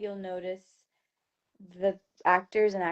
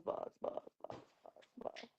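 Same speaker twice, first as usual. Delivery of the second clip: fading to a whisper; 20 dB quieter than usual. Pretend. Chant fading out.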